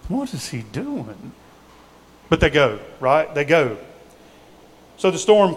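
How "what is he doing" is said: puzzled mutter. Retold or imitated. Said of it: "disciples are probably smart enough to go".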